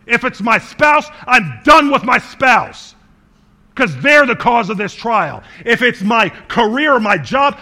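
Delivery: loud, driving sermon voice; full-force preaching. Acted. Preacher warns the congregation about rash choices in trials, loud. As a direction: hammer the point home to the church.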